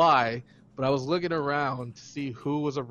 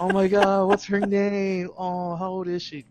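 gushing; 4 semitones higher, 4 dB louder than usual. Retold or imitated. Drunk cooing at baby.

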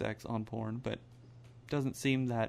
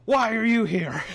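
louder, higher mock-angry yell; retold angry outburst; 6 semitones higher, 9 dB louder than usual. Man cursing his body while driving rough roads, retold angry outburst. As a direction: build to an exasperated shout, half laughing. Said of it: "I'm just getting angry".

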